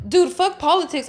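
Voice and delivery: imitating a fed-up outburst; mock exasperated snap. Retold snapping at customer.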